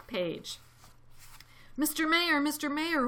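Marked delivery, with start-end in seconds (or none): 1.8-3.1 raised, louder urgent call. A narrator's reading. Elephant summoning the mayor, calling out. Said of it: "Horton called".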